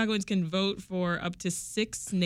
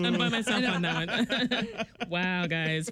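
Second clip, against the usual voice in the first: laughing through the words; slower than usual. Real, not acted. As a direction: chuckle while saying it. Laughing at being outvoted.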